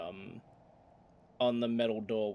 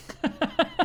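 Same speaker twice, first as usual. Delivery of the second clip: booming orc laugh; 8 dB louder than usual. Acted.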